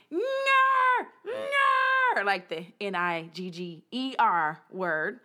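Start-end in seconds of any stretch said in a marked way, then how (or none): 0.1-2.2 very high, loud yelled syllables; mock shout. Politician yelling the practised word, high loud shout. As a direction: bellow it like a forced practice yell. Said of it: "The guy yells out".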